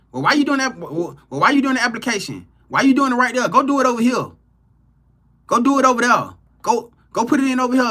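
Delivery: pushy caller who won't let you be; mocking nosy caller. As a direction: nag like a busybody friend. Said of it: "They call your phone".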